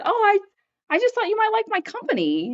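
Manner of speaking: high-pitched mock-innocent excuse; coy innocence. Acting her coy reply by text.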